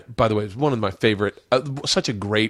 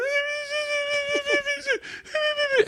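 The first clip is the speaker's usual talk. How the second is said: man imitating his own high, drawn-out crying squeal; very high sustained wail, put on for the story. Husband crying in front of his wife, high squeal. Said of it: "when I cry, I squeal".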